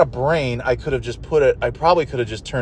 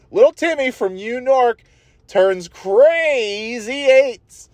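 much higher, drawn-out mock announcer; U Nork DJ voice; 11 semitones higher, slower than usual. Mocking the DJ's New York joke, high slow announcer voice. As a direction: stretch out crazy eights like a cheesy DJ. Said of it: "he says U Nork".

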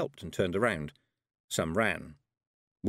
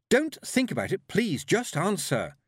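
high, insistent plea; urgent pleading; 10 semitones higher, 4 dB louder than usual. A narrator's reading. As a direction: cut in and beg urgently. Tiffany cuts in, pleading.

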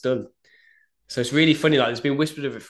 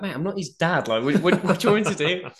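exasperated retort; 7 semitones higher, 3 dB louder than usual. Retold or imitated. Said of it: "And I'm like".

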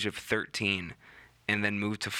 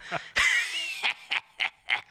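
smoker's rasp laugh; 8 semitones higher than usual. Shown as a demonstration. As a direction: push every bit of breath out in a rasping laugh. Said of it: "get all the breath out".

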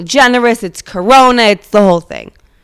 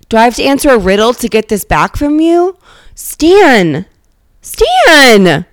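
higher-pitched, gushing interest; mock fascination; 4 semitones higher than usual. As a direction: play exaggeratedly riveted. Acted gushing fascination with Stan's job.

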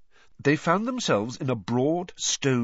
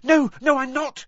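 high, loud, quick protest; acted flustered denial, pitched up; hasty denial; 6 dB louder than usual. A narrator's reading. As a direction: jump in to deny it. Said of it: "she said hastily".

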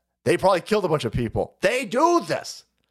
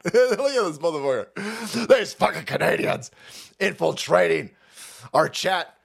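normal talking voice

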